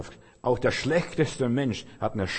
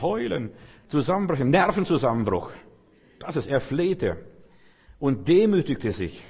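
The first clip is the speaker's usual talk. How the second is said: normal talking voice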